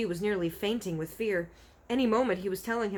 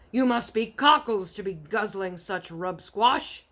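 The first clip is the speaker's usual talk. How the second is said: loud, scornful giant; mocking giant; 3 semitones higher, 7 dB louder than usual. A narrator's reading. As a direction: sneer it loud. Giant mocks BFG.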